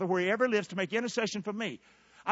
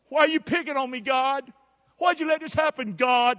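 imitated complaint; 7 semitones higher, 4 dB louder than usual. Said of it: "it's what Lee said".

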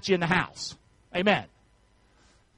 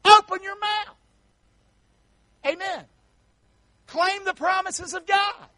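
preached exhortation; 9 semitones higher than usual. Real, not acted. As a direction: urge them to speak out.

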